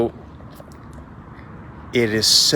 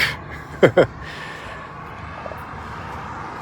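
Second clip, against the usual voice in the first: mocking laugh; 7 semitones higher than usual. Monk laughing at the samurai.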